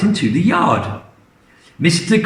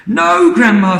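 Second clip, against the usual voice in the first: high, louder no; alarmed refusal; 8 semitones higher, 5 dB louder than usual. Acted. George refusing Grandma the cup, high urgent no. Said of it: "said George".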